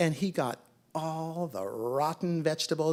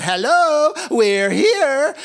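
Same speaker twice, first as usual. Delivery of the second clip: high, loud sing-out at the door; long, high-pitched calling-out; yelling; 11 semitones higher, 14 dB louder, slower than usual. A narrator's reading. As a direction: holler to announce your arrival. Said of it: "and they yelled".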